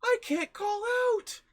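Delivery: put-on high, slow old-lady voice; imitated grandmother, slow and very high; grandma impression. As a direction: complain like a distressed grandma in falsetto. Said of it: "And she was like".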